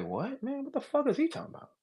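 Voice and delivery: mock disbelief, voice pushed up high; incredulous retold reaction. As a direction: play the confused rapper asking what he's talking about. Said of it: "And you say".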